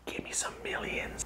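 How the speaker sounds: soft whispered aside; hushed whisper, fading to almost nothing. Retold or imitated. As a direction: whisper it like a secret deal. Imagined secret pitch to LRG.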